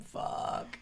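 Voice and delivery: whispered "Oh, fuck"; mock-panicked whisper. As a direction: breathe out the dread. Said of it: "it's like".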